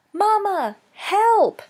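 high, loud scream for help; panicked cry. Acted. Gosling seized by the hunter, crying loudly for mother. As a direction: cry out at the top of a child's voice. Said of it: "cried Bright Bill as he was pulled up".

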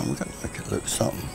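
usual speaking voice

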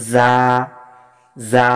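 "জা" with a bird-like buzzing z, drawn out; চিঁ চিঁ আওয়াজ. Shown as a demonstration. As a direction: demonstrate a chirpy, buzzing "জা". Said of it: "পাখির মতো চিঁ চিঁ আওয়াজে". ("জা" পাখির মতো চিঁ চিঁ আওয়াজে)

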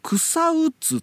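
草うつ said slowly, kusa-u-tsu; old spelling variant. Shown as a demonstration. Host lists old record spellings, 草うつ slowly as kusa-u-tsu. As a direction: spell out 草うつ by sound, drawn out. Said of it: "っていう風にみんな書いてんのよ".

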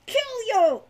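loud, high monster shriek; shrieking kobold. A narrator's reading. Captive kobold threatening Hawk, shrieking. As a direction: shriek the threat at your captor. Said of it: "it shrieked suddenly".

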